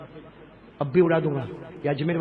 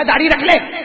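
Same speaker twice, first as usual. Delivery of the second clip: loud urging; 9 semitones higher, 7 dB louder than usual. Retold or imitated. Preacher quotes himself urging.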